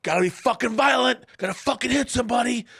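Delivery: high, loud coach-style yelling; linebacker hype. Retold or imitated. Retelling a linebacker on Hard Knocks, hyped yelling. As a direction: pump the team up, bark it high and loud. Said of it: "he was in there going".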